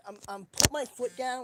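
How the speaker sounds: ordinary delivery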